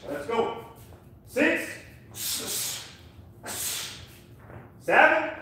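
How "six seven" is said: boxing coach calling combos. Real, not acted. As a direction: bark the numbers out to the class. Coach shouting combo counts.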